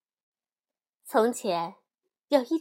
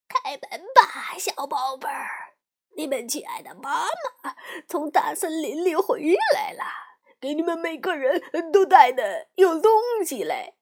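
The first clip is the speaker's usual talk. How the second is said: 狼捏着嗓子大声装成妈妈; 假装亲切地大声叫门; 狼喊着装妈妈; 5 semitones higher, 5 dB louder than usual. A narrator's reading. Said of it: "一边喊". (狼第三次敲门大声冒充妈妈)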